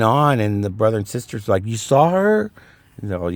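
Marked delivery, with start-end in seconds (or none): 1.6-2.5 high, rising incredulous question; retold wide-eyed disbelief; amazed siblings. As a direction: imitate astonished kids, pitch shooting up.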